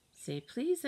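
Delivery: high bossy mom-like correction. A narrator's reading. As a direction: play a big sister copying mom. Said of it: "in my best mom voice".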